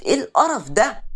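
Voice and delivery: high, loud cry of disgust; disgusted outburst. Acted. Sereen wakes to onion smell. (صيحة اشمئزاز عالية)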